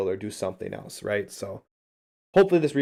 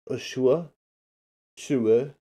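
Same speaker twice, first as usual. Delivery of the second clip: mock French accent; 2 semitones higher than usual. Shown as a demonstration. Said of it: "I'm not sure how I'm saying that".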